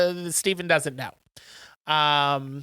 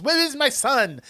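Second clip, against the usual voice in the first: high-pitched worried dad; mock frantic; 8 semitones higher than usual. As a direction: imitate a father bursting in to find his son. Second frantic dad bursts in, retold.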